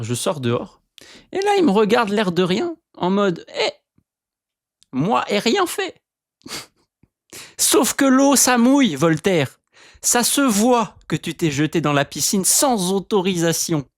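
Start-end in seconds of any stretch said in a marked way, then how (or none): 1.3-6.0 voicing the dog. 7.6-13.9 mock scolding the dog.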